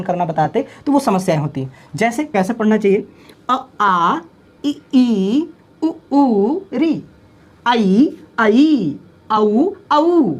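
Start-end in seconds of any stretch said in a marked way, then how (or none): 3.5-10.3 अ आ इ ई उ ऊ each vowel said clearly, long ones held; correct vowel pronunciation. Shown as a demonstration. Teacher showing students correct vowel sounds. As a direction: pronounce each vowel, short then long. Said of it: "ये है आपका pronunciation".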